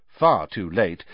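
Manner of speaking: usual speaking voice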